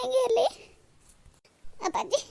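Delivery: tearful rising call to father; a sob caught in the word; crying call. Acted. (ಅಳುತ್ತಾ ಅಪ್ಪನನ್ನು ಕರೆಯುವುದು)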